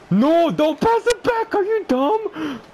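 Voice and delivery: furious high shout. Real, not acted. Gamer yelling at his player for passing back. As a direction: scream it at the screen.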